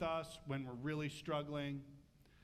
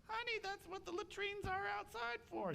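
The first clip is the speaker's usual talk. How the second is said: much higher, sweet nosy wife voice; curious wife. Retold or imitated. Wife asking husband what he's digging, high voice. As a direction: play the puzzled wife, light and high.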